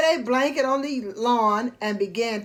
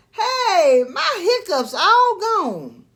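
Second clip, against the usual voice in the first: excited; 7 semitones higher, 4 dB louder, slower than usual. Acted. Goofy delighted on waking.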